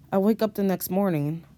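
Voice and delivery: ordinary delivery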